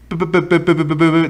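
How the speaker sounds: repeating pe like a nervous stutter; mock the girlfriend's stammering; imitated stutter. Retelling the girlfriend caught lying, mock stammer.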